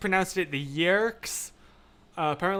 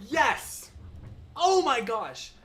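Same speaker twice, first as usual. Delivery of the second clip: high, emphatic burst of recognition; excited agreement; 9 semitones higher, much slower than usual. Real, not acted. Streamer reacting to Susan's comment, excited. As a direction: jump on it with sudden enthusiasm.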